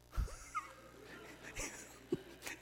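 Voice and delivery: high airy laugh; a soft wheezing laugh; breathy laugh. Real, not acted. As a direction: laugh under your breath. Laughs at own heartbreak.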